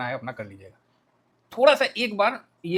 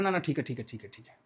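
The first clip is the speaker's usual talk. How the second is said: lower, softer, fading away; mock backing down; 5 semitones lower, 8 dB quieter than usual. America backing down to Iran, mock fading placation. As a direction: let the repeated words tail off almost to nothing.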